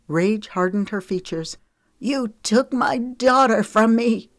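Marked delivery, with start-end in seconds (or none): 2.0-4.3 much higher, louder, slow furious roar; a grieving mother roaring in fury. Acted. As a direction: roar it at him in rage. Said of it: "she roared".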